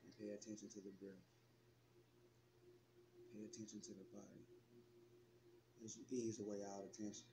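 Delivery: soft meditation voice, long pauses between phrases; guided meditation. Acted. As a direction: guide them gently into relaxation, leaving space to breathe.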